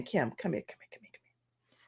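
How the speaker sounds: fading into a voiceless whisper, imitated; dying whisper. Dad passing away calls his daughter again, imitated whisper. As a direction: call someone close with the last of your breath. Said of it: "he was whispering".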